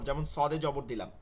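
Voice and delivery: ordinary delivery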